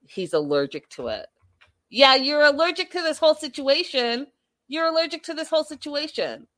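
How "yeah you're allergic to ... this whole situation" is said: higher, louder mocking retort; scornful comeback; sarcastic jab. Real, not acted. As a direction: throw his excuse back at him.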